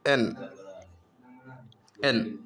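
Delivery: एन said clearly as "en", held apart; teacher modelling एन as "en"; slow sound-out. Shown as a demonstration. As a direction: model एन slowly for the class, "en". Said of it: "इसको एन पढ़ेंगे".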